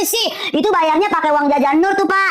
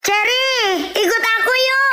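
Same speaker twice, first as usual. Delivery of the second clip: high, eager call to a friend; excited invitation; 6 semitones higher than usual. Acted. Nur invites friend to come along, excited.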